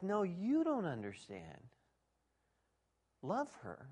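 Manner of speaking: slow, pressing on YOU; calm insistence. Retold or imitated. Pastor gently insisting to divorcing man, slow. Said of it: "I'm like".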